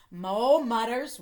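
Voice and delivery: ordinary delivery, nothing marked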